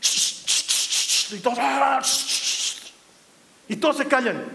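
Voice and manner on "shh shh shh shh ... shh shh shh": slow unvoiced shushing. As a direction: hush the whole army. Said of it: "yo me lo imagino a Josué que agarra y dice".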